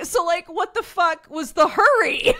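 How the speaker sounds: high, loud mock outrage; indignant. Brother complaining about the rushed movie, mock outraged.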